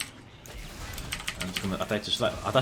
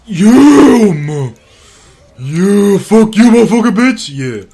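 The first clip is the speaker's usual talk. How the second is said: high, very loud victory shouting; long whooped yeah into gloating profanity; triumphant yell; 8 semitones higher, 15 dB louder, much slower than usual. Real, not acted. Gamer crowing at a beaten enemy, triumphant yell.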